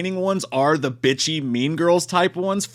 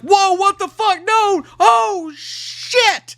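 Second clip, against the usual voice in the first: very high, loud shriek of shock; over-the-top screaming reaction; mock horrified shouting; 5 dB louder, much slower than usual. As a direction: yell in hammed-up horror, building to a drawn-out last word. Acts out friends witnessing the killing.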